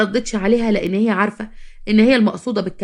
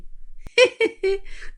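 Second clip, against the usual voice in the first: high, brief acted giggle; acted laugh; 13 semitones higher than usual. Friend laughs at mother-in-law's boast, high giggle.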